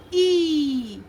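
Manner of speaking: "E" drawn out as a long ee; stressed long E. Shown as a demonstration. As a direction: stretch "E" into a long, emphatic ee. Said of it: "You stress on the sound".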